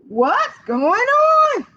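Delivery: high, slow, drawn-out rabbit voice; surprised rabbit. Acted. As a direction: stretch it out wide-eyed. Rabbit asks.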